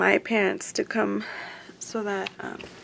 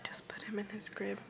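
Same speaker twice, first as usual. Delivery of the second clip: whisper; 6 semitones lower, 17 dB quieter than usual. Real, not acted. Mother whispering over her baby's crib. Baby asleep in hospital crib.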